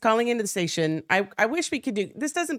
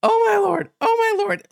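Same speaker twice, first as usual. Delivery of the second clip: high, loud cry of disbelief; hammed-up shaken deputy; shocked deputy; 13 semitones higher, 6 dB louder than usual. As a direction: retell the deputy's stunned outcry. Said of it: "and he's like".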